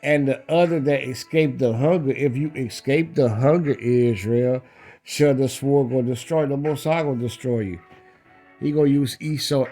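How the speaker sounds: ordinary talk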